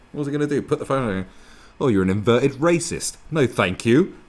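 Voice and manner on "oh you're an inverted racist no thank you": loud, high mock indignation; mock refusal. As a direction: turn her down with hammed-up outrage. Acts out refusing the girl.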